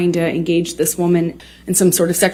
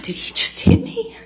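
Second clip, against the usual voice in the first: breathless, much higher whisper; stunned whisper. Real, not acted. Recalling the first slap, whispered in disbelief. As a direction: barely voice it, in shock.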